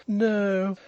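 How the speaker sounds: high, languid drawl; overbearing, high-pitched drawler; languid toff. A narrator's reading. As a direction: agree with lofty languor. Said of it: "the eyeglass agreed languidly".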